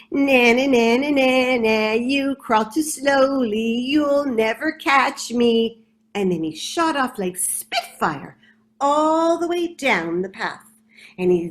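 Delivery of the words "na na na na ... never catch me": louder sing-song na na jeer, slowing down; mocking rat chanting na na and jeering; disdainful taunt. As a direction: taunt her like a smug schoolyard rat. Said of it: "with full disdain he looked at her".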